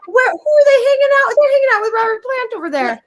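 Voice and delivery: fast, high excited gush; excited fans. Retold or imitated. Festival crowd spotting [name], excited fast gush.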